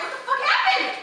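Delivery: high, loud, laughing disbelief; a laughing what-just-happened yelp; shocked. Retold or imitated. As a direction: blurt it out in stunned disbelief. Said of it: "I'm like".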